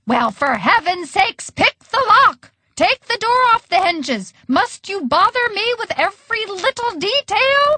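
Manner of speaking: high-pitched screamed orders; exasperated scream. A narrator's reading. Grandma to waitress while playing, exasperated scream. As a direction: let the irritation climb into a scream. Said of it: "screamed Grandma Evelyn".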